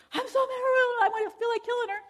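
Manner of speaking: high, loud, half-laughing fury; imitating angry husband. Husband calling the counselor, high and heated. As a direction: blurt it out heated and fast. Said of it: "and he said".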